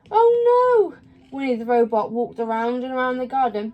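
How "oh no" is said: high, loud shocked wail; dismayed cry. Acted. Winnie wails in shock as she turns into a robot, high and loud.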